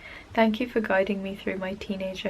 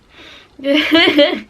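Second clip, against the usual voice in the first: high, loud burst of laughter; 7 semitones higher, 11 dB louder than usual. Real, not acted. Fan laughing at her own joke about her boyfriend, loud laugh. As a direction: laugh out at yourself.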